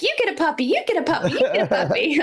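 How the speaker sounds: high-pitched mock British giveaway cry; hammed-up British, sing-out delivery; British Oprah. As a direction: do a talk-show host handing out prizes in a British accent. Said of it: "She sounds like British Oprah".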